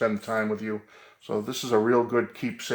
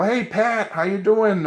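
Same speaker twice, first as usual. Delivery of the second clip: eager greeting; 10 semitones higher, 5 dB louder, slower than usual. Retold or imitated. Greeting his hero eagerly.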